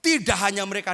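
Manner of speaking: loud, high preaching cry; preaching at full force. Real, not acted. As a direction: belt it out to the whole church. Preacher building to climax. (seruan khotbah yang lantang)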